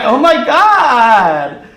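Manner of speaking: high, loud, delighted gasp of recognition; mock-stunned excitement; amazed squeal. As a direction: squeal it like seeing your twin. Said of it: "I'm like".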